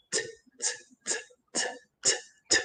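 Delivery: isolated "t" sounds, voiceless and soft, evenly spaced; drum-like final t. Shown as a demonstration. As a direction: tap out "t" like a drum beat. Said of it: "lleva un sonido como un tambor".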